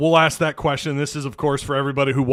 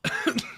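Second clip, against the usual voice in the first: sharp choking cough; coughing up water; 5 dB quieter than usual. Real, not acted. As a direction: cough from water down wrong pipe. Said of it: "That one went down the wrong pipe".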